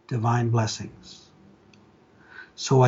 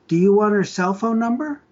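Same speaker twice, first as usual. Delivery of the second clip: put-on much higher, little-girl voice; small child voice; 8 semitones higher than usual. Little girl tells caller about mommy, childlike. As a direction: play the little girl on the phone. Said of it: "The voice of a small child".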